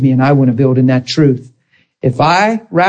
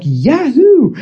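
high, drawn-out mock cheer; elated whoop; 9 semitones higher than usual. Preacher mimicking folks who feel healed, elated whoop. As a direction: whoop it up like a celebration.

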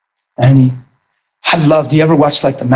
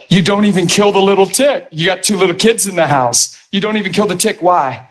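usual speaking voice

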